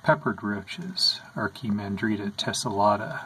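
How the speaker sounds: normal talking voice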